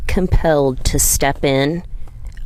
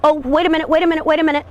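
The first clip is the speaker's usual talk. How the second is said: much higher, urgent wait a minute; alarmed interruption; 13 semitones higher, much faster than usual. Shown as a demonstration. Showing viewers the signal to stop, high alarmed plea. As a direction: stop them mid-act with startled concern. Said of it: "This is the body language".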